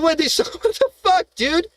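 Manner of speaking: usual speaking voice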